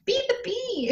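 put-on very high playful child's bee. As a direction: chirp it like a giggly kid. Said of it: "she'll be like".

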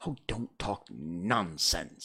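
low, deliberate dismissal; bold. A narrator's reading. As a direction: stand up to him, taking your time. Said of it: "said Alice more boldly".

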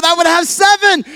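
high-pitched eager schoolboy; excited child's answer. Acted. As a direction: pipe up confidently as a little kid. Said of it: "Johnny so excitedly said again".

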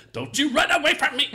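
high, loud, laughing imitation of an angry mom; mock mother yell. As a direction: yell it like mom chasing her kid, half laughing. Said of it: "you would hit on each syllable".

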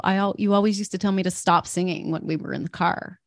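ordinary talk

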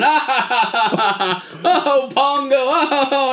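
big high theatrical cackle through the words; mock laughing dad. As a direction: imitate dad helpless with laughter. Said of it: "he's like, he's just laughing".

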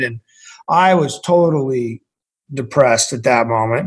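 normal talking voice

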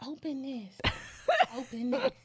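soft, giggly mock sweetness; hint of a sappy, giggly wife; mock gushing. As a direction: tease a sappy gift reveal. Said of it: "they're like".

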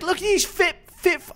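high, hammed-up British show host; Love Island voice. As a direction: gush like a reality show narrator. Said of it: "I don't do that voice watching Love Island".

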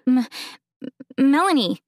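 stammered in shock; stuttering. A narrator's reading. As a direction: stumble on the first sound. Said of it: "I stuttered".

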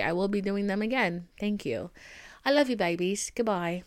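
usual speaking voice

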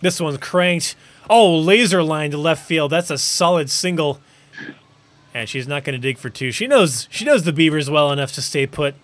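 lively baseball announcer call; sports play-by-play. Acted. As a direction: call the game like a broadcaster.

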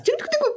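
high-pitched vocal typing noise; imitated keyboard clatter. Describing fingers on keyboards in a montage, imitated clatter. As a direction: rattle off mock typing sounds.

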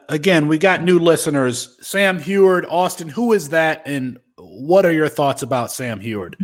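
usual speaking voice

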